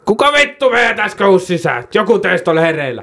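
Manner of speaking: high, angry policeman voice; mock angry cop. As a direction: snap at the room like an irate cop. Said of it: "ihan vitun vihasena".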